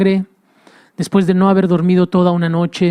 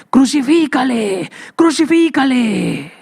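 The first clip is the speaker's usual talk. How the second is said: crowd shout; 6 semitones higher, slower than usual. Acted. Preacher echoes crowd chant.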